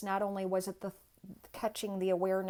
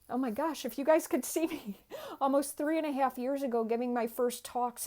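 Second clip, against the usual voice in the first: excited laughing recall; 6 semitones higher, 4 dB louder than usual. Real, not acted. Laughing recalls her first talks.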